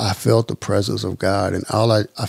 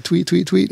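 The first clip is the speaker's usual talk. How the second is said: high imitated bird chirps; bird tweets; 10 semitones higher than usual. Man mimicking the birds he heard at dawn, high chirps.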